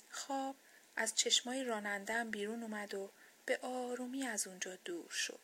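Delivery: ordinary delivery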